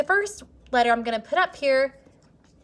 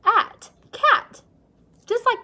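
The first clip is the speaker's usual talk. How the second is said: "cat" sung out high and loud; bright blended word; 12 semitones higher, 7 dB louder, slower than usual. Shown as a demonstration. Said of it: "So it sounds our k".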